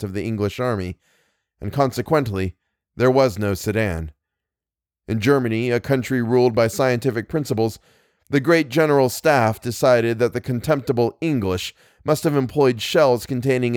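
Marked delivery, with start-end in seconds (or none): none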